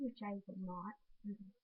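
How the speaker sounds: normal talking voice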